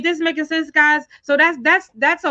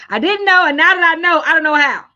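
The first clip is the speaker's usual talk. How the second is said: louder and higher, hammering each phrase; loud emphatic echo; 5 semitones higher, 8 dB louder than usual. Retold or imitated. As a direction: echo the viewer's words back loudly with punch. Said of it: "That's what you're saying?".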